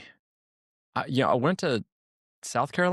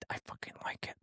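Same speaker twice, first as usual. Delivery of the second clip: whispered confession; 17 dB quieter than usual. Real, not acted. Confiding aside to Ryan.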